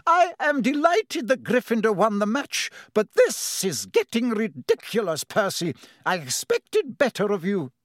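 high, stern reprimand; furious scolding. A narrator's reading. Professor McGonagall scolding Percy over the noise, furious. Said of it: "stared furiously around".

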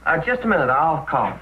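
ordinary delivery

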